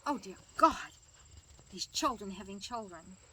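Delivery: mock-horrified outburst; appalled nurse. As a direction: retell a nurse's exasperated shock. Retold nurse's shocked outburst.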